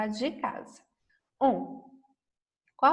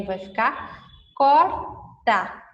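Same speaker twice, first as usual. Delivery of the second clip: slow and clear; 5 dB louder, much slower than usual. Shown as a demonstration. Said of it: "Se eu colocar o R".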